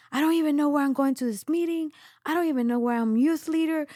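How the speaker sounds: high, loud, fast tearful outburst; distressed outburst. Retold or imitated. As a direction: it bursts out of her. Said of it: "out of nowhere, I'm like".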